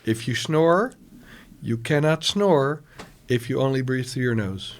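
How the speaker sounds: ordinary delivery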